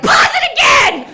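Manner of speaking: high loud demanding yell; mock manic shout; frantic shout. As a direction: bark the order in manic panic. Demanding dad pause TV.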